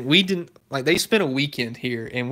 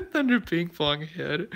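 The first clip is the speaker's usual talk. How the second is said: slow, higher-pitched words through giggles; laughing hard; 5 semitones higher, slower than usual. Real, not acted. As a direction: still cracking up as you repeat it. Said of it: "I'm laughing so hard at that".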